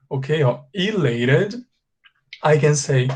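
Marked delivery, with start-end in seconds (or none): 0.7-1.5 ELATED slow, rising at the end; slow careful repetition. Shown as a demonstration. Teacher repeats words so students catch them, slowly. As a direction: draw out "elated" with a lifted ending. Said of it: "Now, a little bit slower".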